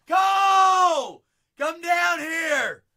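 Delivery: yelling down the stairs; shouting. Real, not acted. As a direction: call out loudly to someone far away. Calling friend from downstairs.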